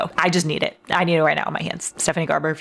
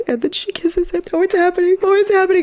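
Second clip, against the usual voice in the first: excited squeal; 13 semitones higher than usual. Real, not acted. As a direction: shriek with joy as it unfolds. Squealing as characters kiss.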